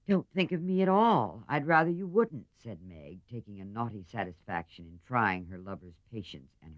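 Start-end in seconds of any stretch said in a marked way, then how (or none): 0.1-2.5 much higher and louder, teasing; petulant. A narrator's reading. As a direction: dismiss him with naughty pleasure. Meg teasing her lover.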